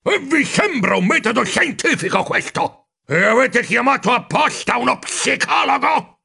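high indignant rodent voice; indignant squeak. Acted. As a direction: squeak out your outrage. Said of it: "Rakt squittì, con i baffi che vibravano per l'indignazione".

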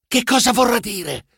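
mildly alarmed stage murmur. A narrator's reading. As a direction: ask it with a touch of alarm. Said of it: "sussurrai allarmato".

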